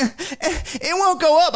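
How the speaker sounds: put-on high whining child; whiny kid voice. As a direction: whine it like a frustrated little kid. Kid voiced trying the shade.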